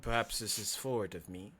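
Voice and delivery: low, hushed, halting speech; quiet, courteous, eerie stranger; creepy fox-man, tentative. Acted. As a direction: pause long and speak softly, feeling your way. Game master plays Kel.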